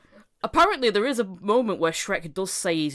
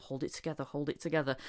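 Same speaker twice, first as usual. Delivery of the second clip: much softer, low self-talk; muttered to himself; 4 semitones lower, 9 dB quieter than usual. Retold or imitated. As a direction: mutter it under your breath to stay calm. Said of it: "he's like saying stuff".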